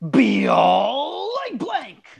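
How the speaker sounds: slow dramatic reading. Acted. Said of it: "I like the way you read that".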